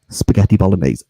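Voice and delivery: low, booming imitation of an order; dramatic reveal. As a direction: announce the dish loud and low, like a scandal.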